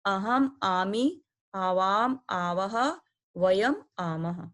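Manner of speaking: each pair of words drawn out slowly for students to repeat; slow recitation drill. Shown as a demonstration. Teacher reading the pronoun endings aloud for pupils, slow and deliberate. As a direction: spell out अहम आमी, आवाम आवह, वयम आमह word by word, very slowly. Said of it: "तो आप इसको पढ़िए आमी".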